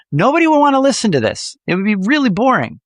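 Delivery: high, lilting, animated delivery; melodic voice. Shown as a demonstration. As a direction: swing the pitch up high. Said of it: "add a little bit of melody into your voice".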